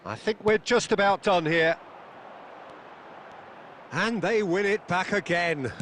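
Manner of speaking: ordinary talk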